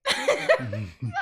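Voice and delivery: shrieking giggle fit; a high, helpless laugh; high squealing laugh. Real, not acted. Laughing fit over hiccups.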